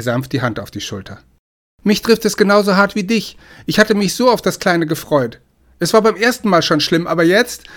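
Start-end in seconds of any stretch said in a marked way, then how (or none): 1.8-7.6 higher, louder emotional plea; distraught, near breaking. A narrator's reading. Man to girlfriend after losing their baby, distraught. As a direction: plead through grief, voice raised. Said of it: "Seine Stimme brach".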